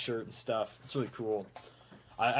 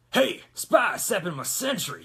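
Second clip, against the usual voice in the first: put-on rougher Texas accent; loud, high Texas holler; Engineer; 7 semitones higher, 7 dB louder than usual. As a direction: call it out in rough Texan. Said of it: "He's got a rougher Texas voice".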